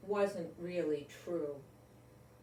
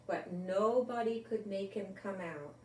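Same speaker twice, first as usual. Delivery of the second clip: usual speaking voice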